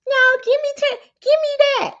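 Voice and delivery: very high, loud mock grabbing; greedy woman. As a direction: squeal a mock demand. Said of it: "y'all was like".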